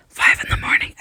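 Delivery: loud, forced stage whisper; incredulous whisper. Real, not acted. Vlogger stressing how late she stayed up, incredulous whisper. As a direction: whisper it hard in disbelief. Repeats the hour in disbelief.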